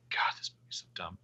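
exasperated whisper under the breath. Real, not acted. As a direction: breathe it out in disbelief. Aside about the dumb movie.

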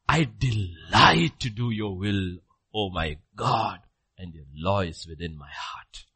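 slow, measured reading of the psalm; scripture reading. Real, not acted. Preacher reading Psalm forty to the church, slow. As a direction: savour each word of the verse.

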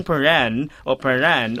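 'Paran' in two full syllables; spelling pronunciation. Shown as a demonstration. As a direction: sound out both syllables of 'Paran' as written. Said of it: "yung isa pa yung Peran".

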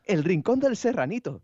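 high, slow mock Japanese tourist voice. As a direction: deliver it as the deadpan tourist punchline. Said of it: "Y me dice el japonés".

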